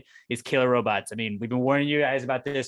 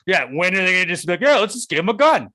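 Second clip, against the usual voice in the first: sarcastic mock; 6 semitones higher, 6 dB louder than usual. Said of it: "I've been like".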